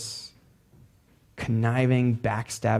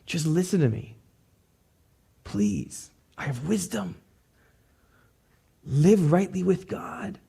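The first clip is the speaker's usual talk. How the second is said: pitched higher, pleading call to the crowd; Lady Wisdom crying out to the foolish to listen; 7 semitones higher, slower than usual. Acted. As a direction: call out to fools in the street, then plead. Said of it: "And she's calling out".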